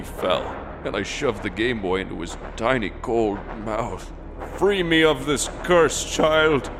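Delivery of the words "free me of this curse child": high, hammed-up desperate yell; screamed plea. A narrator's reading. Player to the dead child while falling, screaming. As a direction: cry out for release at high pitch. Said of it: "I screamed at the top".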